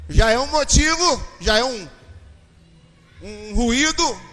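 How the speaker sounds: loud, punchy preaching burst; emphatic shout. Real, not acted. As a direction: hammer the point home. Exclamação enfática no sermão. (brado enfático de pregador)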